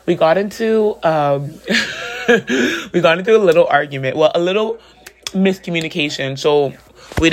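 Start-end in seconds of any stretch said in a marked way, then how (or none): 1.6-2.9 high giggle. Real, not acted. Laughs recalling argument.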